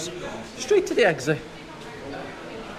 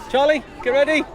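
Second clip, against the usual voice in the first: higher, louder call to a friend nearby; rally your mate to get set; excited heads-up to a friend; eager call to Charlie; 9 semitones higher, 4 dB louder than usual. Real, not acted. Cueing friend to trigger donkey.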